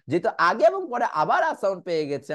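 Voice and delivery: ordinary talk, nothing marked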